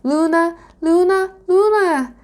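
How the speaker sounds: high, loud repeated calls; desperate cries. A narrator's reading. Dragonling cries for Luna as the beast lunges, desperate. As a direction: cry out, each call climbing. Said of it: "he cried as the beast began to lunge".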